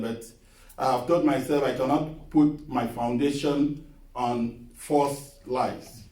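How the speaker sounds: ordinary talk, nothing marked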